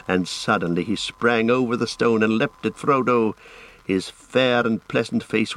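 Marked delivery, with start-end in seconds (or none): none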